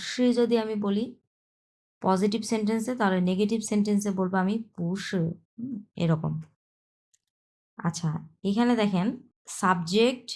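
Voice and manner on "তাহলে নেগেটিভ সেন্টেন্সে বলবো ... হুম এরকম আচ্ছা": normal talking voice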